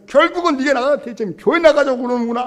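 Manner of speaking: father yelling, high and loud; a father shouting at his churchgoing son; angry shout. Retold or imitated. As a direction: accuse him at full voice.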